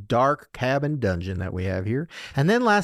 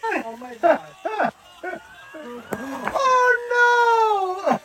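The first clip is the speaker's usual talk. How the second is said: crying out; much slower than usual. Real, not acted. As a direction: cry out in dismay. Replaying the fall video.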